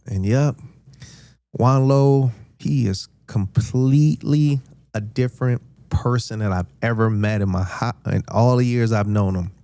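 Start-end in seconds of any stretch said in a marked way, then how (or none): none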